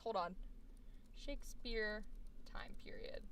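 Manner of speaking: very soft, low, absent mumble; muttering to self. Real, not acted. Streamer looking up Shakespeare's dates, muttering quietly to self. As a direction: murmur the search words under your breath.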